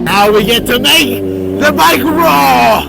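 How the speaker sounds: high, loud yelling on a motorbike; shouting over engine. Real, not acted. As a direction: yell over wind and engine noise. Shouting while riding motorbike.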